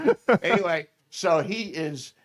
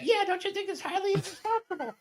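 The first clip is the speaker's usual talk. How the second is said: high, fast, tiny echoing sidekick voice; little voice; faster than usual. Retold or imitated. Aide parroting the senator from the background, high tiny voice. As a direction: squeak it like a yes-man parroting his boss. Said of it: "this little voice in the back".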